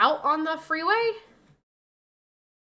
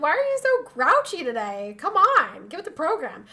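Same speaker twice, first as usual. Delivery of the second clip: mock coaxing; 3 semitones higher, 5 dB louder than usual. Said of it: "I'm like".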